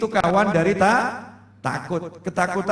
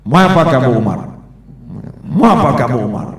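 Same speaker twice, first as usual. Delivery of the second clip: loud, stern, bold challenge; commanding challenge; 6 semitones lower, 6 dB louder, faster than usual. Retold or imitated. The Prophet confronting Umar at the door, loud and commanding. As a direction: confront him fearlessly at the door.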